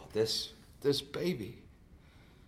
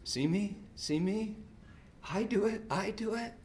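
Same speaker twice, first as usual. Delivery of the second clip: higher, softer voice asking to be noticed; childlike plea; 7 semitones higher, 6 dB quieter than usual. Retold or imitated. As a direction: plead like a small child. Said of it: "We say to the world".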